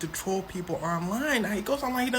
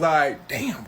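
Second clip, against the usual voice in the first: louder startled damn; mock exclaim; 6 dB louder, slower than usual. [name] reacting to [name] leaving, loud exclaim. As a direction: blurt it out.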